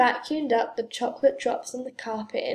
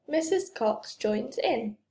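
usual speaking voice

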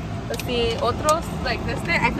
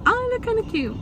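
pleasantly surprised; 11 semitones higher, faster than usual. Real, not acted. Sees herself on camera.

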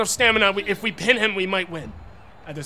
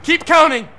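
loud, higher barked commands at the ref; shouting orders; 5 semitones higher, 9 dB louder than usual. Real, not acted. Gamer ordering the referee to count, loud shout. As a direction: yell it at the screen.

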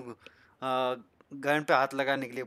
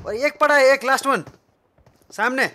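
higher, louder excited call; excited callout; 11 semitones higher, 12 dB louder than usual. Real, not acted. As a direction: point out the last enemy. Excited callout of last enemy.

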